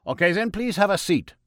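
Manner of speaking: put-on high, loud German-accented doctor; a jolly German doctor offering cocaine; Freud in German accent. A narrator's reading. Freud offers God cocaine, German-accented and high.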